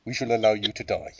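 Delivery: lightly done muffled villain voice, as if through a glass; menacing villain, speaking into a cup; Bane voice. Pretend. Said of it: "talking into a glass to sound like Bane".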